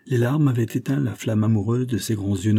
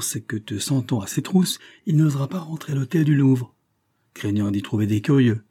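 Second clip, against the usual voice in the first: ordinary delivery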